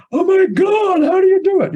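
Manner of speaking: high, loud, overwhelmed cry of panic; panicked outcry. Retold or imitated. First-time TED speaker panicking about his talk, high loud outcry. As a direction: throw up your hands in mock panic.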